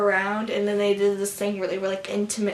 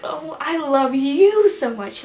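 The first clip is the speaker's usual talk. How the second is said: higher, louder, slow imitated voice thing; Luke; 4 semitones higher, 5 dB louder than usual. Luke hugging a fan back, his drawn-out voice thing imitated. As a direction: swoop up and stretch the words out. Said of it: "how he does that voice thing".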